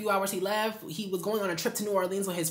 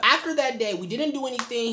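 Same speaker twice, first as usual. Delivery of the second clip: ordinary delivery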